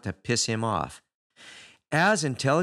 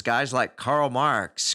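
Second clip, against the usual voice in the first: ordinary delivery, nothing marked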